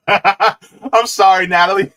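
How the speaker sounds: real laughter, loud and high; slow, high, laughing "sorry"; laughing apology. Real, not acted. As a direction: apologize through a burst of laughter.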